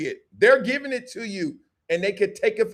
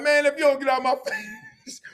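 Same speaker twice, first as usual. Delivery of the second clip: higher, laughing mock-fed-up father; exasperated dad; 7 semitones higher than usual. Dad fed up with the crying toddler, laughing mock exasperation.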